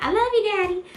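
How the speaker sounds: high-pitched, sugary child imitation; sweet little girl. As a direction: chirp it like an adoring daughter. Voicing the sweet little daughter.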